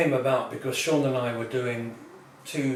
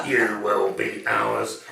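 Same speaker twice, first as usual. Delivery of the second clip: slow, lower robotic threat; menacing robot; 3 semitones lower, 5 dB louder, much slower than usual. Acted. Robot cornering the diner in the toilet, slow menace. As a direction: loom over them, slowly.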